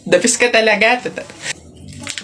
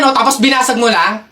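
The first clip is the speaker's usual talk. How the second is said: ordinary talk, nothing marked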